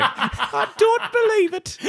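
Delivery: very high, slow falsetto; mimicked pigeon soundbite. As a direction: parrot the pigeon line, laughing. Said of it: "That's the best soundbite from today".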